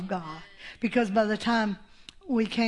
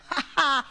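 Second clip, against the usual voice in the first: short burst of delighted laughter; 4 semitones higher than usual. Real, not acted. Preacher delighted at the devil's defeat, laughing. Laughs after her triumphant line.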